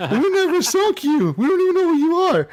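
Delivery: very high, mock-innocent protest; hammed-up high-pitched indignation; imitated bewildered denial. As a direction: squeal innocence as the accused player.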